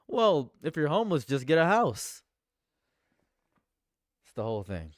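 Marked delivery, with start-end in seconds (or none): 0.1-2.2 mocking quote. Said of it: "It's the same logic as saying".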